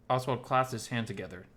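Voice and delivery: ordinary delivery, nothing marked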